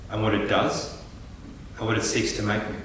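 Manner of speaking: usual speaking voice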